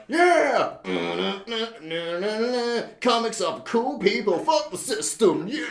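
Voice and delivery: high, loud hammed-up rock yell; mock rocker cheer. Mocking the credits song, high loud rock yell. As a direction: whoop it up like a dumb rock anthem.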